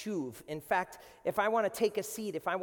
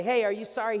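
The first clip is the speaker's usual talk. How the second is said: calling out; 4 semitones higher, 10 dB louder, faster than usual. Retold or imitated. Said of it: "whenever you say".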